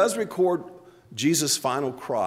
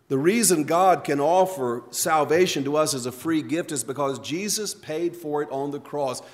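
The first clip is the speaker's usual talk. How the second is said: ordinary talk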